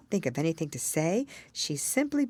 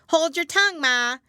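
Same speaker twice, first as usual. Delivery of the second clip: much higher, louder snappy retort; snappish young crab; 13 semitones higher, 8 dB louder than usual. A narrator's reading. Young crab snaps at its mother, loud high retort. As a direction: snap back at mother. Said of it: "said the young crab a little snappishly".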